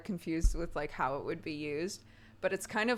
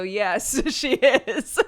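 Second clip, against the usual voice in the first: bursting into laughter, higher and louder; laughing; 4 semitones higher, 12 dB louder, much slower than usual. Real, not acted. Joking that she is Cockney, laughing. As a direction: laugh the words out.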